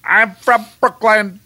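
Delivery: slow, high, loud Brooklyn accent; deep Brooklyn accent. Shown as a demonstration. Instructor drilling taxi trainees, slow heavy Brooklyn accent. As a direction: draw out an exaggerated Brooklyn accent. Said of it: "practice this deep Brooklyn accent".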